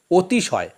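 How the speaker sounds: অতিশয় with the first vowel said as ও, otishoy; অতিশয় উচ্চারণ অতিশয় নয়, ওতিশয়; spoken as otishoy. Shown as a demonstration. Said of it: "ধ্বনি হিসেবে তার পরিবর্তে আসছে ও".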